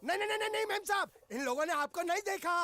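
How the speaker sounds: high, panicky string of denials; flustered denial. Acted. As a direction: protest frantically to the boss's lady. Manager caught out by madam.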